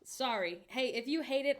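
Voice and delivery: ordinary talk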